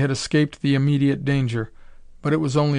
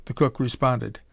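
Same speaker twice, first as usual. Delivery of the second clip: plain reading voice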